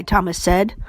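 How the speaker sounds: plain reading voice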